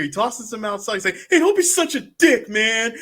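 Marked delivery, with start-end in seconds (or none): 1.3-2.9 high, loud protest; high-pitched complaining shout, played for laughs; mock indignant yell. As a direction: yell it up high like you're being thrown out. Acting out man thrown from house.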